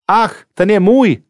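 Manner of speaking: understated high exclamation from a narrator; exclaiming. A narrator's reading. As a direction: exclaim it with a high lift, not a full shout. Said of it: "vykřikla žena".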